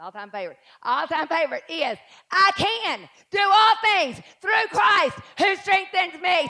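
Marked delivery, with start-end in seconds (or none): 2.3-6.5 loud, slow declaration; slow, loud scripture proclamation; proclaimed scripture. Shown as a demonstration. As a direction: proclaim it slowly and boldly. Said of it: "Say it".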